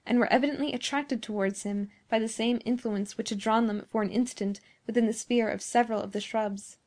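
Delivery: ordinary delivery, nothing marked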